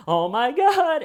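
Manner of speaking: high, loud mock shock; mock shocked gasp. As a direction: squeal it like a shocked wife. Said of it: "And she goes".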